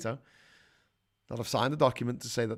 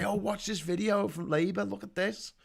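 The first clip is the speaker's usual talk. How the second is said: eager viewer; 8 semitones higher than usual. Pretend. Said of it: "and say".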